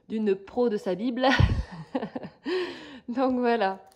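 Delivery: laughing through the words at her own joke. Real, not acted. Crafter joking to viewers about her pattern bible, laughing. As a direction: let a real laugh carry the line.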